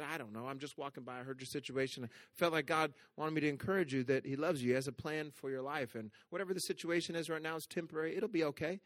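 soft encouragement. Retold or imitated. Pastor telling the crying mother that God loves her, soft and tender. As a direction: quietly comfort a stranger in distress. Reassuring the surprised woman.

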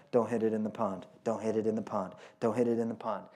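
low, soft nervous muttering to yourself; anxious golfer self-talk. Retold or imitated. Golfer on the tee warning himself, low nervous mutter. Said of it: "you're like".

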